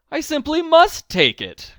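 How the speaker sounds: put-on can't resist the baby tiger; excited cry. A narrator's reading. Old man finding a striped kitten, excited cry. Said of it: "cried the very old man".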